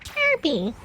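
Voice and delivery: high-pitched cartoon cry, badly faked; Kirby. Streamer failing at a character impression, high put-on cry. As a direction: attempt a high little game-character voice. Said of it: "I can't do a Kirby impression".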